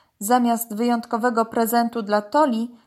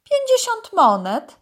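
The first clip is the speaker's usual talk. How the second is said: much higher, louder price announcement; python trader; 13 semitones higher, 5 dB louder than usual. A narrator's reading. Python tells dog the price, high sales pitch.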